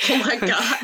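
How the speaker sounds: bursting into laughter; laughing. Real, not acted. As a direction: blurt it out while laughing. Laughing at friend's joke.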